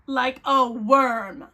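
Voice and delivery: "Like a worm" drawn out slowly and loud; appalled emphasis. Real, not acted. Reader appalled by the crawling man, "Like a worm" loud and slow. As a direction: land hard on every word of "Like a worm", stretched.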